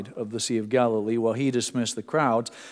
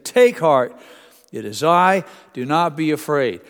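shouted over storm; 4 semitones higher, 8 dB louder than usual. Shown as a demonstration. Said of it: "I don't think this would have been a calm".

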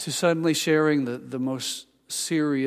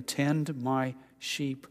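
slow command; 6 dB quieter, much slower than usual. Retold or imitated. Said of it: "he said to him".

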